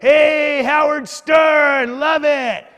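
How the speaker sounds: loud, high, drawn-out street holler; imitating a biker's whooping shout. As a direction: yell it like a rowdy biker hailing a celebrity. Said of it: "homeless man, dressed up as a Hollywood biker".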